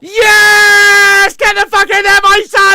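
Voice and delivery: roaring high and loud in celebration; ecstatic goal scream. Real, not acted. Fan celebrating his team's goal, ecstatic scream. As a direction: scream with pure joy. Team scores winner.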